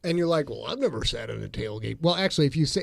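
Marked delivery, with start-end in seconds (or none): 0.5-2.0 low, soft imitation of a drawling accent; mock accent. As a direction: mimic that accent, lower and softer. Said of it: "if you say it with that accent".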